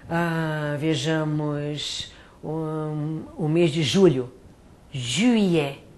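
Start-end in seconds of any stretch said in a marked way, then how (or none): 4.9-5.8 Juillet with rounded French u lips. Shown as a demonstration. Teacher showing students the French u in Juillet. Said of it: "fazer um bico com a boquinha".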